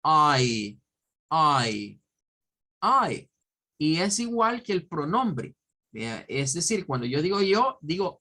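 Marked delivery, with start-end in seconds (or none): 0.0-3.3 eye said as a clear long I, repeated; model pronunciation. Shown as a demonstration. Teacher teaching how to say eye, the word for ojo. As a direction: stretch eye into one open vowel. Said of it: "para decir un ojo es eye".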